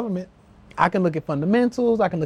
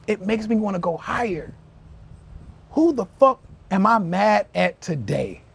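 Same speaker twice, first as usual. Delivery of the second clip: normal talking voice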